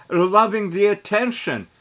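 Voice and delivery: ordinary talk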